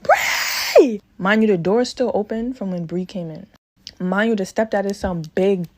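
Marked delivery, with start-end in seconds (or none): 0.0-1.0 mild mock yell. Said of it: "So I'm screaming like".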